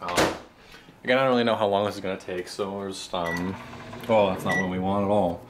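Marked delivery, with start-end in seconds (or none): none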